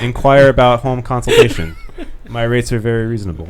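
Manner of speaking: loud, laughing joke advertisement; mock sales pitch. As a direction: pitch it like a cheesy ad.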